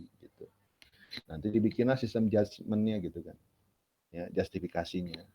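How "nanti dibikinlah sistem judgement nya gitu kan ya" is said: ordinary talk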